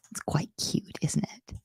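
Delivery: soft amused whisper; a softly whispered aside. Real, not acted. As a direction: breathe it out under your voice. Aside about "boop camp.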